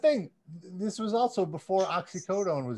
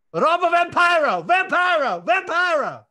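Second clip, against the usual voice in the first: put-on loud, higher Russian-accented shouting; hammed-up shout; 8 semitones higher, 7 dB louder than usual. Announcing a fan name to the crowd with a hammed-up shout. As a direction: bellow the name like a wrestler announcer.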